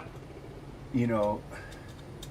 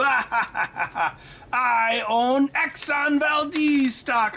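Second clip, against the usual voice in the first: laughing; 5 semitones higher, much slower than usual. Real, not acted. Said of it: "joking through laughter".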